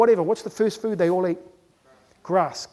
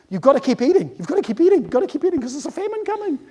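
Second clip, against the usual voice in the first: higher, urgent repeated warning; nagging genetics; 6 semitones higher, faster than usual. Acted. Genetics warning of famine, high insistent nagging. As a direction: nag with rising urgency. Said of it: "Our genetics keep telling us".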